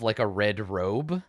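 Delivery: ordinary talk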